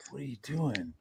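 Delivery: hushed, voiceless disbelief; baffled whisper. Retold or imitated. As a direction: whisper it, genuinely at a loss. Baffled, not mad, at muddy girls.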